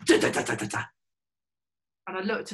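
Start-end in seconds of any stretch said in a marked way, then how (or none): 0.0-0.8 shaking plane noise. Retold or imitated. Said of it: "it went".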